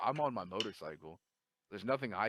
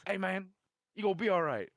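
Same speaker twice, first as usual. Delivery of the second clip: put-on higher, louder voice of a kindly older lifter; older gym regular, higher and louder; old gym guy voice; 11 semitones higher, 9 dB louder than usual. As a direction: play the nice old Puerto Rican guy at the gym, a touch pitched up. Old Puerto Rican guy voiced.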